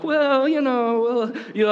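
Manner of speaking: high, wavering mock-timid voice; hesitant waffler. Preacher mocking anyone unsure before ten thousand, high and hesitant. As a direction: play someone high-pitched and unsure, stalling. Mimics someone timid facing battle.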